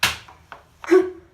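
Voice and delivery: cackling loud laugh. Real, not acted. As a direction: burst of loud high laughter.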